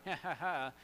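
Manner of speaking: mock sinister chuckle; hammed-up wicked laugh; evil ghost laugh. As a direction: laugh like a plotting villain. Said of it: "it was an evil ghost".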